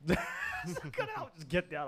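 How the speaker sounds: high, laughing imitation of grandma; slow, high-pitched scolding through a laugh; mock scolding grandma. Grandma ordering grandson out of the tree, high mock scolding. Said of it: "She's like".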